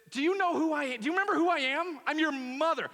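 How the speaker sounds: high, loud, hurt mother pleading with her son; mother reproaching her lost son, high and loud; indignant mother. Acted. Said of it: "she wants him to know".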